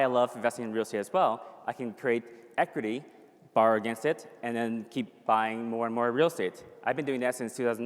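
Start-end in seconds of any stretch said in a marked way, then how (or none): none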